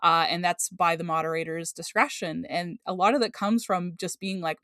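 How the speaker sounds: ordinary talk, nothing marked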